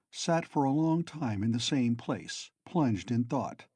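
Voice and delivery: usual speaking voice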